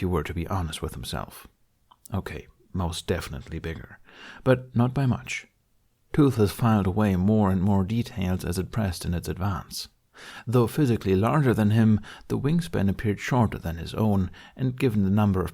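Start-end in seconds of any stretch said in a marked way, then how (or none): none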